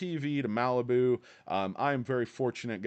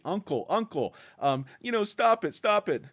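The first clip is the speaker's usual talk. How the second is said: higher, pleading mock cry for mercy; mock begging shorts; 8 semitones higher, 3 dB louder than usual. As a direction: ham up a whiny surrender. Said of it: "They wanna say".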